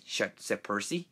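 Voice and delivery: ordinary delivery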